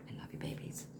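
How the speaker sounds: soft whisper to the pets; whispered love. Real, not acted. Telling her dogs she loves them, whispered. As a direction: breathe it tenderly to the dogs.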